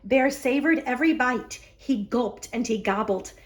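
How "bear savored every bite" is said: plain reading voice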